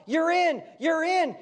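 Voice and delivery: high, loud triumphant call; joyful shout. Acted. Preacher shouting to the congregation that they belong, high and loud. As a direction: shout it to them: you're in.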